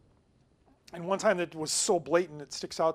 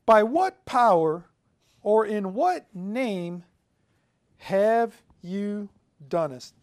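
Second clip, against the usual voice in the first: very slow and louder, each word weighed; slow dramatic questioning; 7 dB louder, much slower than usual. Retold or imitated. Preacher voicing the religious leaders' challenge, slow and loud. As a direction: draw out the accusers' question as if reading to the whole room. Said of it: "they began to inquire".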